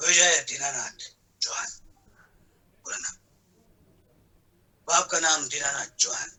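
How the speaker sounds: low, halting screen-hero impression with long pauses; measured, deep delivery, each answer left hanging; Amitabh Bachchan. Favourite Agneepath police station scene, done as an Amitabh Bachchan impression. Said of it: "Amitabh जी का अग्निपथ का scene".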